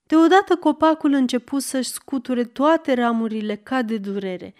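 plain reading voice

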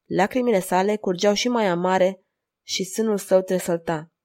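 ordinary delivery

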